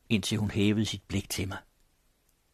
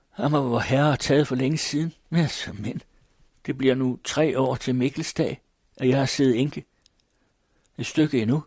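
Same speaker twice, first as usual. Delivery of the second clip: lightly voiced old widow; 5 semitones higher, 4 dB louder than usual.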